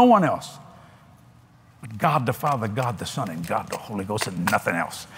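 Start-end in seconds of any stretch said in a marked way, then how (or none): 1.8-5.1 fading to hush. Acted. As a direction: let it fade into reverence. Preacher drops to reverent hush.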